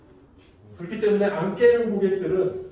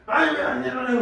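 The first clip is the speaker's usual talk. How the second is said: higher-pitched irritated complaint; exasperated, raised-pitch acted grumble; annoyed, scowling; 8 semitones higher than usual. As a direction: act fed up with a sleeper who won't wake. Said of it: "짜증나는데 심지어 인상 쓰면서 막".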